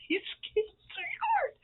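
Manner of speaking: very high, squealing with joy at the gift; delighted squeal. Real, not acted. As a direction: squeal it out, thrilled.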